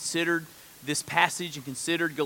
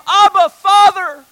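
high, loud, slow cry to a father; drawn-out fervent shout; 14 semitones higher, 15 dB louder, slower than usual. Acted. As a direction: call out with full force. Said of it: "Would we hear the sound".

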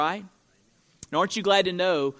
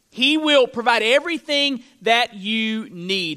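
raised, emphatic pulpit voice; energetic sermon delivery with lifted key words; forceful preaching; 5 semitones higher, 4 dB louder than usual. Acted. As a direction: drive the point home to the congregation.

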